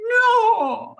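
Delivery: high loud shriek of denial; disbelieving no. Retold or imitated. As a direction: cry out no in shock. Child's disbelieving cry.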